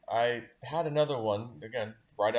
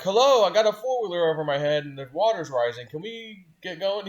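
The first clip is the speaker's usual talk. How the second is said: louder, higher exasperated plea, slowing at the end; impatient; 8 semitones higher, 7 dB louder than usual. Retold or imitated. Man holding a four-wheeler overhead while the water rises, exasperated. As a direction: urge them on with mock exasperation.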